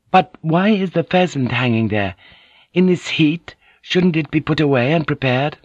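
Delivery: high, politely questioning lady; puzzled woman interpreter. A narrator's reading. Mariko questions the hanging pheasant.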